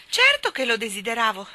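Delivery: high, loud, startled protest; very surprised. A narrator's reading. Jane answers the trout, very surprised. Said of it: "disse Jane molto sorpresa".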